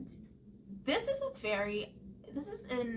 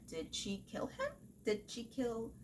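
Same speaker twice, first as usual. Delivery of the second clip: normal talking voice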